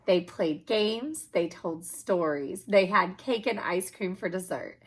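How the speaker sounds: usual speaking voice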